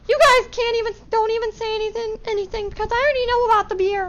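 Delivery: very high, scolding vice principal voice, rushing at the end; shrill-pitched mock scolding principal; imitating vice principal. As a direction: mimic the vice principal cutting them off.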